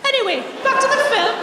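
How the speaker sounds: high, loud, breezy brush-off; blatantly obvious subject change, pitched right up; unsubtle change of subject. Acted. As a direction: swerve away loudly, as if nothing was said. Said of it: "I didn't say they were subtle".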